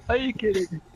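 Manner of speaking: high-pitched mock disbelief; incredulous. Gamer doubting a friend's zombie story, incredulous. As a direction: ask as if you cannot believe it.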